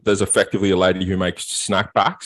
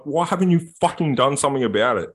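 angry demand; 6 semitones higher than usual. Retold or imitated. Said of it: "I'm like".

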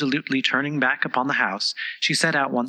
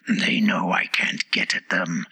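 panting whisper; 6 semitones lower, slower than usual. A narrator's reading. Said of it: "it panted".

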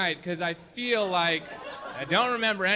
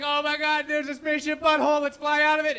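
excited discovery; 8 semitones higher, 4 dB louder than usual. Pretend. Pilot spotting the exit.

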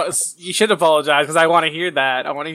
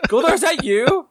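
mock suspicious hero; 8 semitones higher than usual. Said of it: "playing Tommy guessing".

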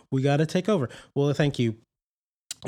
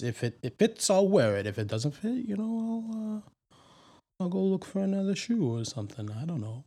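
higher hesitant co-host voice; Terry voice; 7 semitones higher than usual. Acted. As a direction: play the hesitant sidekick Terry.

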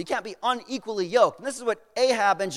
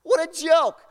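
scoffing soldiers; 8 semitones higher, 5 dB louder than usual. Retold or imitated. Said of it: "They look and say".

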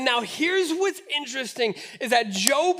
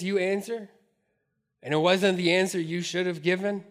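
normal talking voice

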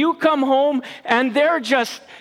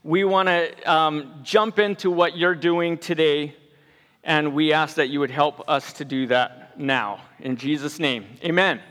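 soft, low opening prayer; hushed pastor's prayer to open; 8 semitones lower, 3 dB quieter than usual. Acted. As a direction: pray quietly before the message. Opening prayer before sermon.

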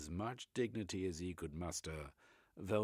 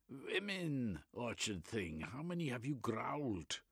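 put-on Reich in a German accent, eagerly expounding; 6 semitones higher than usual.

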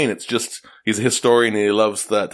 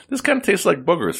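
lightly voiced snowman, pitched higher; snowman voice in a joke; 8 semitones higher than usual. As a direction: pop the punchline up high like a silly snowman. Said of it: "One says to the other one".

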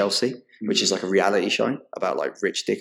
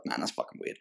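muttered aside; 7 semitones lower, 13 dB quieter than usual. Retold or imitated. Police officer reacting.

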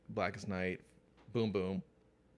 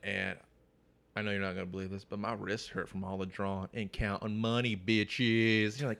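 ordinary talk, nothing marked

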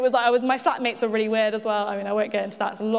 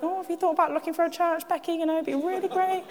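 gentle nudging mum; 4 semitones higher, 3 dB quieter than usual. Retold or imitated. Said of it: "Mum did the whole classic".

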